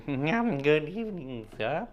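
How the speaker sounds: put-on slow, quavering voice of an ancient old man; Henry Crun voice. Host greets Roy as the Goons' Henry Crun, slow and quavering. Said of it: "I'm gonna do Henry Crun for Roy".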